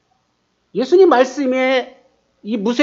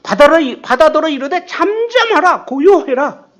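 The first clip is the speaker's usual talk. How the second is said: higher, forceful word of authority; commanding rebuke; 6 semitones higher than usual. Acted. Jesus rebuking the wind and sea, commanding. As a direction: command the storm to be still. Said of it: "바람을 꾸짖으셨다고 합니다".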